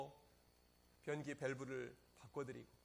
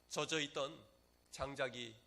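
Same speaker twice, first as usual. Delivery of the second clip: usual speaking voice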